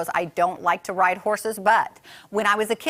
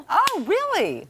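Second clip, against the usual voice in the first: high, slow gasping oh really; surprised; 13 semitones higher, slower than usual. Real, not acted. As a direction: sound truly caught off guard. Gasped reaction to surprising answer.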